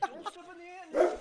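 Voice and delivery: dad yelling at daughter near the ant nest; shouted warning. Retold or imitated. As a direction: yell it before she steps in.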